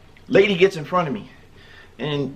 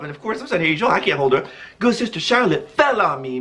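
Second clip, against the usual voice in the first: normal talking voice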